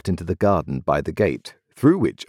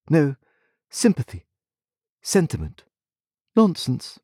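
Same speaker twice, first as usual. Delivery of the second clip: higher, cool and heartless; haughty young lady; 9 semitones higher than usual. A narrator's reading. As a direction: dismiss feelings with icy calm. Estella voiced in reading.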